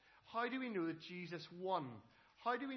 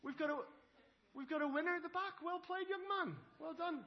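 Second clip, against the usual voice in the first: high, loud excited announcing; excited winner call; 12 semitones higher, 7 dB louder than usual. Real, not acted. Minister to kids, announcing the breath-holding winner excitedly.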